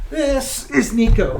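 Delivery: slow beaming introduction of a pet; proud introduction. Real, not acted. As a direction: show off the cat proudly. Presenting the cat to viewers.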